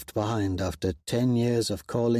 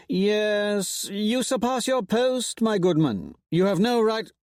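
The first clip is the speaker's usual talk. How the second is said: much higher, haughty rebuke; Cicero indignant; 10 semitones higher than usual. A narrator's reading. Cicero to Roan, indignant. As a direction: put the upstart in his place.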